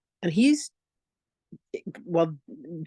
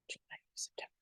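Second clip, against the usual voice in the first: quick whispered correction under the breath; whispered aside; 22 dB quieter, faster than usual. Real, not acted. To her audience, correcting the month, whispered aside. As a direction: whisper the fix to yourself.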